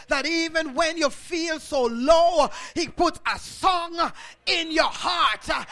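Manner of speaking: loud, rising preacher's shout over the congregation; preaching at full force. Acted. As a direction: thunder it out like a revival preacher.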